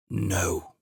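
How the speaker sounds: weak, croaked "No"; croaking old man. A narrator's reading. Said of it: "he croaked".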